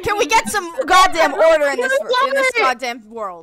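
loud, exasperated shout; shouting for order. Real, not acted. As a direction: yell at everyone to calm down.

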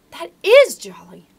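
slow, loud and high with glee; delighted exclamation. A narrator's reading. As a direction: relish the lucky find loudly, drawn out. Boy delighted at finding torch.

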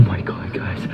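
hushed whisper; whispering while hiding. Real, not acted. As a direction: whisper so nobody hears. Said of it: "'cause I can't be loud".

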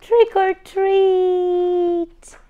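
Trick or treat drawn out slow, high and loud; chorus shout. Acted. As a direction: cheer it together at the door. Crayons all knock.

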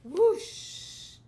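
loud, high rising whoosh. Acted. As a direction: blow a big gust. Wolf blows house down.

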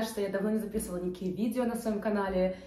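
ordinary delivery

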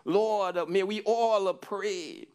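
normal talking voice

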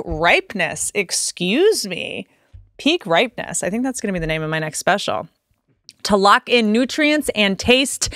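upbeat sponsor read, lightly salesy. Acted. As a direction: sell it like a podcast ad. Reads a sponsor ad.

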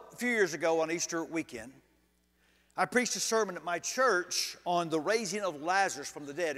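normal talking voice

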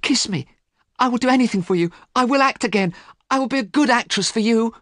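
very high, desperate begging; high-pitched pleading of a rejected lover; crying out. A narrator's reading. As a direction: beg frantically, voice rising in volume. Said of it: "cried Sybil".

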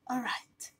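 breathy whisper. Acted. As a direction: whisper it under your breath. Hushed aside.

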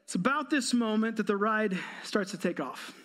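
usual speaking voice

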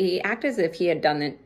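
normal talking voice

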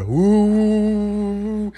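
high, held mimicked fan drone. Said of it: "It gets louder".

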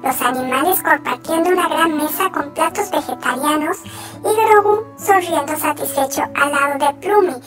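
ordinary delivery

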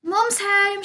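put-on high, child-like call through the house; storyteller's high sing-out shout; excited shout. A narrator's reading. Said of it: "shouted George".

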